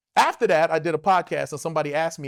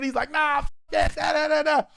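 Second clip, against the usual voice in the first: heated shouting; 9 semitones higher than usual. Retold or imitated. Fight escalating.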